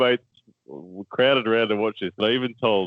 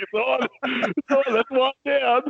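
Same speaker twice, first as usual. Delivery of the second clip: gleeful; 14 semitones higher, slower than usual. Retold or imitated. Celebrating first foul to himself.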